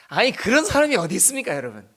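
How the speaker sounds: usual speaking voice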